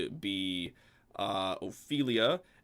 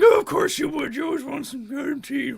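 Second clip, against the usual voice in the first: high, loud fed-up grumble; exasperated troll barmaid; 10 dB louder than usual. Acted. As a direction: roll your eyes at him. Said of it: "she's just like".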